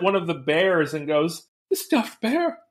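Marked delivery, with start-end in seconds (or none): 1.7-2.6 high, prim mock Countess; admiring Countess. Countess looks at a stuffed bear, high prim admiration. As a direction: coo it like a fussy hostess.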